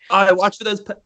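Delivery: rough attempt at an Aussie accent; bad Australian accent. Joking about the Australian classmate, bad Australian accent. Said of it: "I can't even do an accent".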